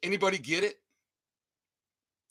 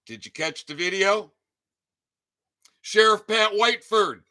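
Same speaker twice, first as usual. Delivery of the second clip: ordinary talk, nothing marked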